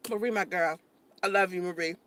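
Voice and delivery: low, soft, intimate affection; tender. Real, not acted. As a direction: drop to a gentle low voice for a dear friend. Same affection, now said tenderly.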